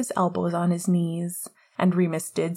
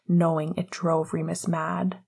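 plain reading voice

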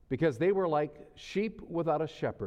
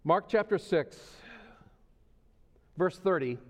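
emphatic call-out; 5 semitones higher than usual. Real, not acted. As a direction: make sure the back row hears it. Preacher repeats the reference louder.

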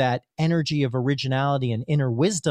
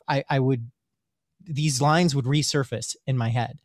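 ordinary talk

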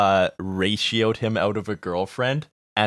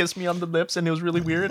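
mocking high voice; 9 semitones higher, faster than usual. Continuing mocking fan voice.

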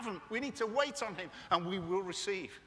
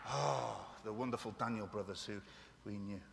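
playful Welsh accent on fire; a light Welsh lilt; 10 semitones lower, 4 dB quieter than usual. Shown as a demonstration. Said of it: "Can we say it with a bit of a Welsh accent?".

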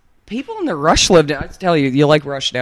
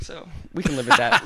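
laughing; 5 semitones higher than usual. Real, not acted. Laughing, first take.

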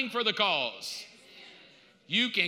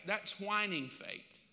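ordinary delivery

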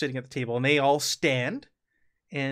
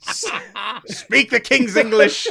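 loud, slow, high Scottish demand; thick Scottish accent; 7 semitones higher, 10 dB louder than usual. Acted. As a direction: order it slowly in booming Scots. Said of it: "replies with a thick Scottish accent".

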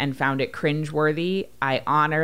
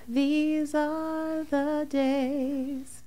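drawn-out wistful; 13 semitones higher, much slower than usual. Acted. Sentimental podcast opener, drawn out.

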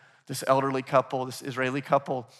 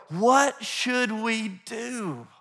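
higher, very slow question; drawn-out appeal; 5 semitones higher, much slower than usual. Retold or imitated. Followers asking Jesus what to do, drawn-out appeal. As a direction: stretch each word in a mock plea.

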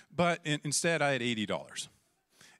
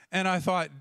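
normal talking voice

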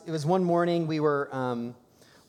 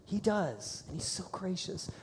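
usual speaking voice

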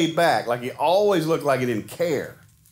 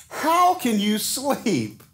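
slow, higher, alarmed plea; alarmed captain; 7 semitones higher, much slower than usual. Acted. Captain pleading with sleeping Jonah, alarmed and slow. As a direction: shake him awake in disbelief.

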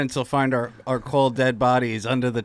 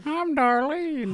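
high, drawn-out Southern character voice; Darlene; 13 semitones higher, much slower than usual. Acted. Introducing herself in the Cliff and Darlene bit, Southern character. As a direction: put on a slow, high Southern belle. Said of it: "he's Cliff and I'm Darlene".

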